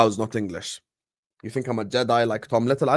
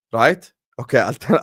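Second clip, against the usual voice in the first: ordinary talk, nothing marked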